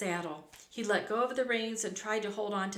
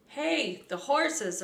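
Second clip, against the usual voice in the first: loud, higher-pitched cry of alarm; alarmed shout; 6 semitones higher, 5 dB louder, slower than usual. A narrator's reading. Rustler alerting the camp to escaping horses, shouted. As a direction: shout a warning to the others. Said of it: "a rustler shouted".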